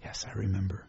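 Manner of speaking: quiet mock sigh of remembering; soft remembering. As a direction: recall it gently under the breath. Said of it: "They'd go".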